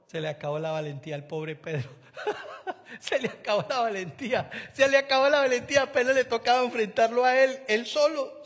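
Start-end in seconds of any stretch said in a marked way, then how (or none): 2.4-4.5 laughing. Real, not acted. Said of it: "risa del predicador". 4.7-7.9 fast, loud and high repetition; preaching shout. Real, not acted. Preacher repeats for emphasis.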